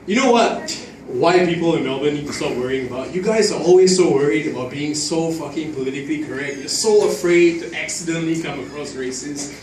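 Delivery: normal talking voice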